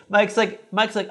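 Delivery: ordinary talk, nothing marked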